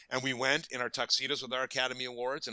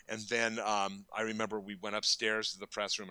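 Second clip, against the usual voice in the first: ordinary delivery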